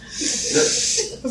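a breathy, high little laugh at being so emotional; embarrassed laugh. Real, not acted. Laughing at herself for getting emotional on air, real embarrassed laugh.

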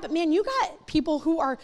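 ordinary talk, nothing marked

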